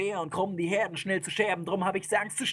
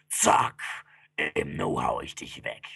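rasped, very low monster growl; menacing, voiceless fiend; threatening demon. Acted. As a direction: bite off the first word, then growl deep.

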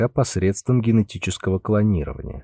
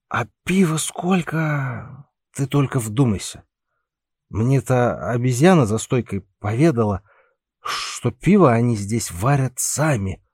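put-on drunk voice; slower than usual. Drunk sailor keeps bragging.

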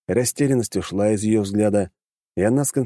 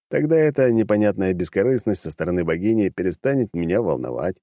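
usual speaking voice

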